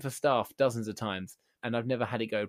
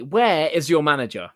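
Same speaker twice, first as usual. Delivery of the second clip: slow, louder demand; demanding yell; 4 semitones higher, 11 dB louder than usual. Retold or imitated. Customer demanding the manager, slow loud demand. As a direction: bark for the manager.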